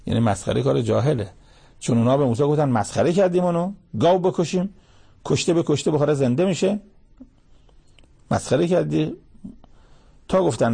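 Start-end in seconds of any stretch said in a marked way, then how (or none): 2.7-9.1 mocking disbelief. People mocking Moses' command.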